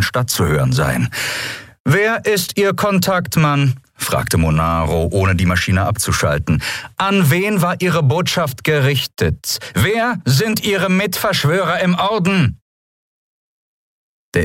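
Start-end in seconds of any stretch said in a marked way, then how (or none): 1.8-3.8 high, slow, pressing questions; cold interrogation. A narrator's reading. Interrogator demands answers. 7.0-12.6 high, slow, pressing questions; cold interrogation. A narrator's reading. Interrogator demands answers.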